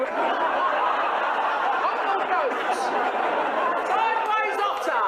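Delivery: high, drawn-out mock yoga shout; yelled commands. Yoga teacher yelling animal poses at her class, mock shout. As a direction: bellow poses at the class for fun. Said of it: "I just yell out an animal".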